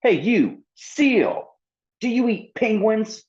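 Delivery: put-on high, loud, furious little girl voice; angry demand. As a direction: confront him, hot and demanding. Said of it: "Lorelei was hot and demanding answers".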